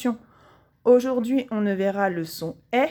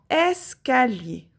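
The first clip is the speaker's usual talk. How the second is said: "escalier" slowly, leaning on the opening "es"; stressed first syllable; 4 semitones higher, slower than usual. Shown as a demonstration. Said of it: "on entend le son est dans la première syllabe".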